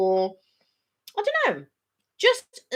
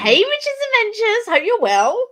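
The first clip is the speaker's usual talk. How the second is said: much higher, louder cheerful hello; excited greeting; 12 semitones higher, 7 dB louder than usual. Real, not acted. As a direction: call out warmly to a viewer. Greeting a viewer on live.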